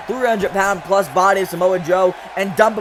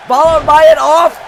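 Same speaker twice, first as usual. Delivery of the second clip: surprised outburst; 6 semitones higher, 7 dB louder than usual. Real, not acted. Stunned commentator spots a move.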